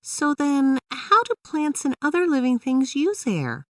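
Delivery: put-on high, curious young girl; a kid eager for the next answer; Emily's voice. A narrator's reading. Emily asks her dad how plants use air, high girlish voice. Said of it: "Emily asked".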